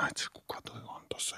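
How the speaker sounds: imitating a friend's secretive whisper; barely audible conspiratorial whisper; hushed whisper. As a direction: whisper it covertly, as if the customer might hear. Friend secretly pointing out celebrity.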